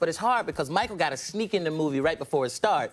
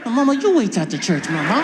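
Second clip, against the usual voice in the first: defiant talking back; 2 semitones higher, 8 dB louder than usual. Retold or imitated. Son to his mother in church, loud defiance. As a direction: throw the threat back loudly.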